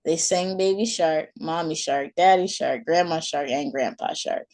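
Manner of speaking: ordinary delivery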